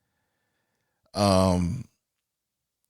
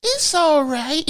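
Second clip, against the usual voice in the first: high, slow, put-on coaxing voice; mock coaxing woman; 3 dB louder, slower than usual. Man mimicking the woman coaxing, high and slow. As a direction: purr it out in a high fake voice.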